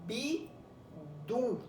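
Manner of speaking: BI ... DU, slow and well apart; syllable by syllable. Shown as a demonstration. Teacher repeats the word to find the strong syllable, bidu split slowly. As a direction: stretch bidu into two separate beats. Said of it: "Você vai falar de novo".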